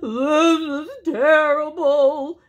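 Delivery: loud, high, drawn-out tearful moan. A narrator's reading. Sobbing bear bemoaning his lot, tearful moan. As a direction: wail it slowly like a crying bear. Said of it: "he moaned".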